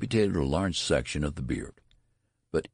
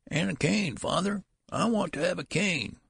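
put-on much higher, thin old man's voice; old son's plea; 14 semitones higher than usual. A narrator's reading. Old son calling down the hall to his father, high and fussy. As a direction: call after him like a fussy old man.

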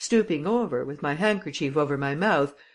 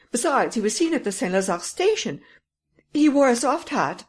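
higher, louder, eager insisting; insistent lady; 7 semitones higher, 5 dB louder than usual. Acted. Lady insisting to the commissary, high and loud. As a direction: press the detail on the commissary.

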